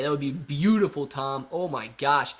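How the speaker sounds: ordinary delivery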